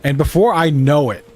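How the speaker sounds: ordinary talk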